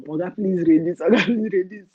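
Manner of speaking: laughing through a pleading "Oga, please reduce"; hammed-up begging that breaks into a laugh; mock begging, laughing. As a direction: trader mockingly begs the tax officer, laughing. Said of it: "find your syndrome".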